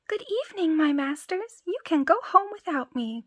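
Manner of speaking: high, cheeky little shout; tiny cry. Acted. Tom Thumb taunts the men from the mouse hole, high and cheeky. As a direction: call out gleefully small and high. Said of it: "cried he to them, laughing".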